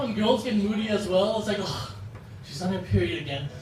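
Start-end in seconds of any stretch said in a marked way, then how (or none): 1.6-3.5 low, soft fed-up groan; imitating an irritated guy's aside; annoyed guy grumble. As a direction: grumble it quickly under your voice. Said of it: "guys get really pissed off".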